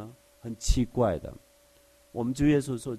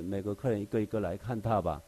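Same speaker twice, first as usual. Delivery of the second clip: ordinary talk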